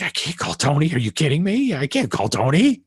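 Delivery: mock panic at the very idea; panicked protest. Singer refusing to call the famous bassist, mock panic. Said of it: "freaking out on the phone".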